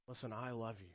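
barely above a hush, full of love; Jesus hushing his love to a child; tender. Acted.

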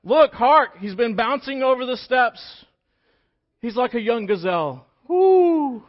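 high, loud, slow delight ending in a whoop; excited bride. Acted. Girlfriend sees her date bouncing up steps, loud and high. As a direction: gush over him as he bounds up. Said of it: "that's what you want your girls to say".

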